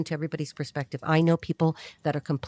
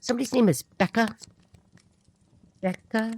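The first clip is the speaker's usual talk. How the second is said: ordinary talk, nothing marked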